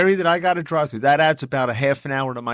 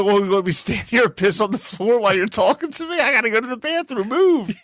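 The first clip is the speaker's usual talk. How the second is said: comic griping that rises to an order; mock exasperation; 9 semitones higher than usual. Husband blocked from the bathroom, mock exasperated. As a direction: pitch climbing as he argues. Acted argument with wife over bathroom.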